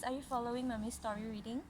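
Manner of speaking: ordinary delivery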